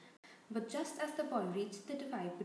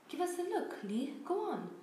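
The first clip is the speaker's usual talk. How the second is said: high, excited pleading; egging him on eagerly; 7 semitones higher, 3 dB louder than usual. A narrator's reading. Excited crowd around Lee.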